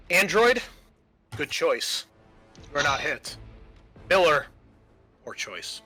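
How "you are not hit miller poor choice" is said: ordinary talk, nothing marked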